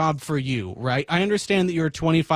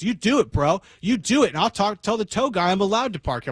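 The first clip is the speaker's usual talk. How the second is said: higher mock bro taunt, quick at the end; 7 semitones higher than usual. Van driver taunting him in a mock bro voice. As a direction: puff up like a sulky teen. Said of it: "a collegiate level bro off".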